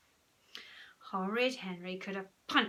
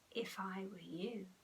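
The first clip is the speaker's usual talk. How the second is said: slow, hushed warning; soft, slow, secretive; whisper; 10 dB quieter than usual. A narrator's reading. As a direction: whisper it ominously to your brother. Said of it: "whispered Henry".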